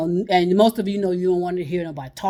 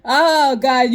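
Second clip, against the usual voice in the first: exasperated laugh; 7 semitones higher, 7 dB louder than usual. Real, not acted. Recovering from laughing fit.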